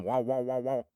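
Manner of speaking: imitated engine revs in short repeats; motorbike engine. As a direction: mimic a bike revving up the driveway. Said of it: "I hear the motorbike engine".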